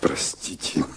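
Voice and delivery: a soft breathy whisper of sorry; whispered apology. Acted. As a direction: breathe the apology almost silently.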